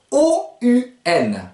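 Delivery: ordinary delivery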